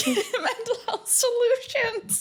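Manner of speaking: slow high laughing. Real, not acted. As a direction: laugh out the name slowly. Laughing at dark joke.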